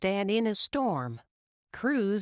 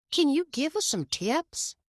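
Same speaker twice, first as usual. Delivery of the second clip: put-on eager young racer; 9 semitones higher than usual. A narrator's reading. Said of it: "said a fellow racer named Danny".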